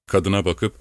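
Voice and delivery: usual speaking voice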